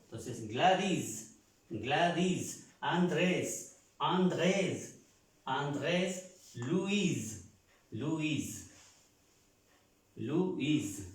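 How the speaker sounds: final S sounded in "Gladys", "Andrés", "Luis", last syllable stressed; S final pronunciada. Shown as a demonstration. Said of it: "esta S se pronuncia en estos nombres". ("Gladys", "Andrés", "Luis" con la S final sonora y acento en la última sílaba)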